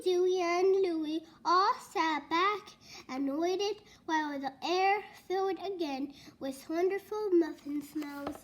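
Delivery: ordinary delivery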